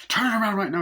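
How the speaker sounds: high, loud retold command; insistent shouted order. Doctor ordering the refusing driver to turn back, mock insistent shout. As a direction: shout the order, pitch jumping up.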